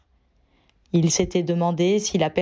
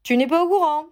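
astonished, much higher; surprised; 9 semitones higher, 3 dB louder, faster than usual. Acted. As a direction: blurt it out in disbelief. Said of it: "s'étonna Ron".

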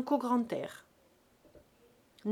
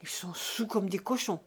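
disgusted. A narrator's reading. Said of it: "d'un air dégoûté".

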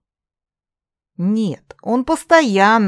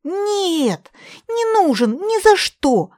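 shrill refusal; 13 semitones higher, slower than usual. A narrator's reading. Katerina protesting.

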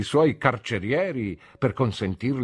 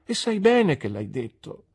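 tearful; 11 semitones higher than usual. A narrator's reading. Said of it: "pianse Dora".

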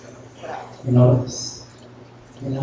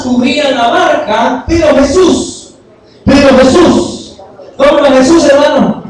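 loud, high, rousing sermon voice; raised voice, driving the point home; fervent preaching; 7 semitones higher, 7 dB louder than usual. Acted. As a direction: preach it out to the congregation. Preaching the storm passage.

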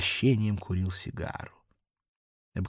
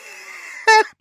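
put-on drunken cackle; 11 dB louder than usual. Drunken gloating laugh.

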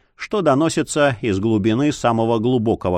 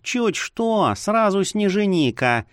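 petulant higher mimicry; capricious mocking; 5 semitones higher, slower than usual. A narrator's reading. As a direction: tease him, pouting and whiny. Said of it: "Обиженно-капризно передразнили его".

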